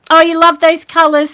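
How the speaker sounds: ordinary delivery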